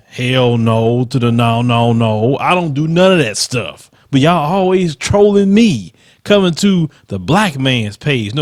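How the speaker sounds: ordinary talk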